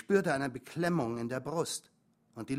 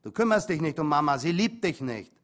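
louder, hurtful jab at the father; angry outburst; 2 semitones higher, 7 dB louder than usual. Acted. Son attacking his father about Mama, angry and louder. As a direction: throw it at him to wound.

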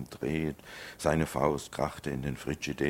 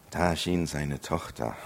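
normal talking voice

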